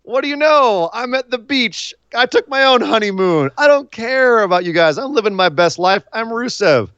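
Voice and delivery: imitating a smug vacationer; carefree Rusev. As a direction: gloat like you're on holiday. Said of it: "And he's like".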